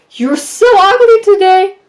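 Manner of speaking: high, loud, slow sneer; mock bully taunt. Bully mocking someone's looks, slow high sneer. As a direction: drag out the insult like a mean girl. Bully insult voiced in advice.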